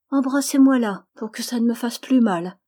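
put-on childlike, in all seriousness; very serious child. A narrator's reading. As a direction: ask in a child's earnest voice. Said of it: "dit-elle avec un grand sérieux".

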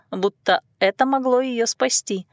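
usual speaking voice

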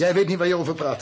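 angry outburst. Acted. Husband shouting down his wife's accusation, angry. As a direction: cut her off in anger. Heated quarrel between the couple.

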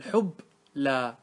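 حب لا broken after a still b; حب لا in two separate syllables; split at the sukun. Shown as a demonstration. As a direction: stop on حب, pause, then say لا. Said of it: "الحرف الثاني ساكن".

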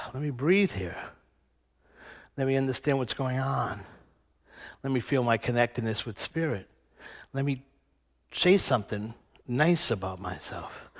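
quiet soothing voice to oneself; calm inner voice. Retold or imitated. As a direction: breathe and settle yourself gently. Calming self-talk, breathing.

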